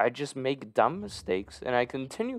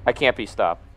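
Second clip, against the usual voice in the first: confident boast; 6 dB louder than usual. Real, not acted. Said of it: "I'm dialing in".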